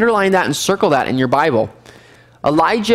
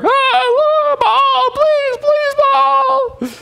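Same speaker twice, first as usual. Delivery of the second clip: frantic pleading cry; much slower than usual. Acted. Priests begging their silent idol in a high frantic cry. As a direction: cry out to Baal like a frantic worshipper.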